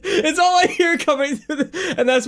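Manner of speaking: real high-pitched giggling through the words; helpless laughter squeezing the voice up high; laughing. Real, not acted. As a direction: try to explain while cracking up. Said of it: "I'm laughing because".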